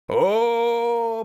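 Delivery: high, loud, drawn-out mocking "oh"; Yuri chiming in. A narrator's reading. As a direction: butt in with a teasing exclamation. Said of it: "подал голос Юрий". (насмешливо подав голос)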